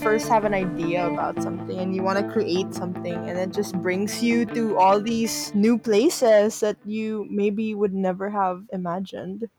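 ordinary talk

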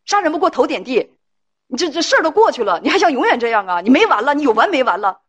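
hands-on-hips yelling. Acted. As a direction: act out an exasperated spouse having a go. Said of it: "这种叉着腰跟人喊".

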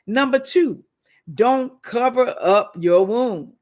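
loud, slow preaching point; preacher calling out the second point; preaching emphasis. Acted. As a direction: drive the point home to the congregation.

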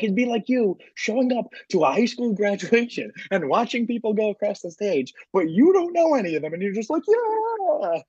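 high-pitched mocking stand-up rant; Bill Burr. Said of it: "butchered a Bill Burr joke".

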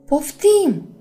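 loud, high startled yell; yelling back. A narrator's reading. Startled Luna answers the one yelling, yelling back. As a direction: shout it back at full volume. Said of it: "urlă la rândul ei".

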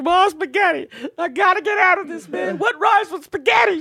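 very high, wailing mock sob-rap; crying rapper, mock. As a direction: imitate a blubbering rapper, high and whiny. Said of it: "crying, rapping, too".

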